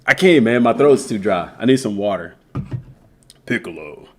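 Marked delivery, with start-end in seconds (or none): 3.5-4.1 very low, soft character voice; Piccolo. Acted. Streamer naming his character, deep Piccolo impression. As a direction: sink the name right down deep. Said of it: "talk like Piccolo".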